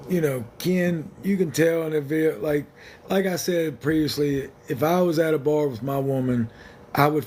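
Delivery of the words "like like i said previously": ordinary talk, nothing marked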